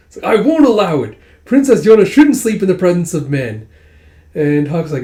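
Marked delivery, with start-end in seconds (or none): 0.3-3.7 high, loud protest; acted outraged objection, raised and emphatic; indignant refusal. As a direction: put your foot down, scandalized. Character forbids sharing the room.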